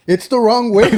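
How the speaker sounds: high, loud mock yell at the driver; retold yell. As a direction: belt it out like scolding a wrong-way driver.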